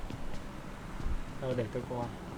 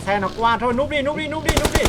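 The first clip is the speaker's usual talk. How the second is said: urgent shout; 10 semitones higher, 5 dB louder than usual. Real, not acted. Urgent warning to hide.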